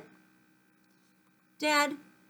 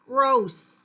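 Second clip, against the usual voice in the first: disgusted; 3 semitones higher, 6 dB louder than usual. Acted. Dad's favourite singer revealed.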